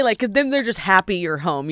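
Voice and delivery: usual speaking voice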